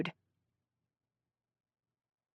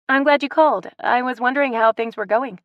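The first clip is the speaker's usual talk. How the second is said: bright, higher greeting to a caller; cheerful; 7 semitones higher, 4 dB louder than usual. A narrator's reading. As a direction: sound glad to hear from her. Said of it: "she said cheerfully".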